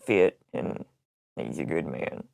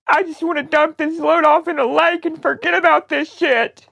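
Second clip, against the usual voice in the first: mock-tearful despair. Prank caller wanting to dump the load, tearful. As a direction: wail it as if at the end of your rope.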